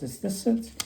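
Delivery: high questioning elf. Retold or imitated. Elf shows the gold blade, high question.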